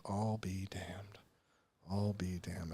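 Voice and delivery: soft, slow, awed under the breath; a father quietly taken aback, barely voicing it; stunned murmur. Dad finding his father's recovery book, hushed and stunned. My dad doesn't really get emotional.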